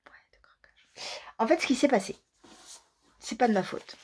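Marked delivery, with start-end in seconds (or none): 0.1-1.2 breathy confessional whisper; whispered aside. Real, not acted. Vlogger confessing two splurges to viewers, whispered.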